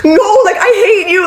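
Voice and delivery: sobbing yell through laughter; retold crying shout. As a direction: relive the meltdown, voice cracking. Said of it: "I'm literally going crazy".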